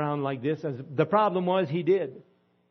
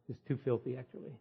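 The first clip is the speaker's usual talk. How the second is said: usual speaking voice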